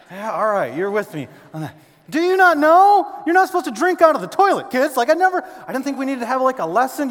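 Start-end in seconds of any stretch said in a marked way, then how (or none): 2.1-7.1 exasperated parent. Retold or imitated. Parent scolding kids about drinking from the toilet, exasperated. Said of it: "Parents, you ever had things come out".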